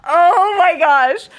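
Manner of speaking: gasping oh my gosh through laughter; overwhelmed by laughter, stretch it out; laughing cry. Real, not acted. Vlogger laughing at her dad's text, breathless laughter.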